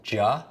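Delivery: "J" sounded out as juh. Shown as a demonstration. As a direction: say "J" as a short juh. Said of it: "is the J sound".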